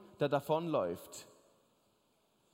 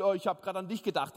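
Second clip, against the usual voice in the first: quick lie; faster than usual. Retold or imitated. Husband's fast lie.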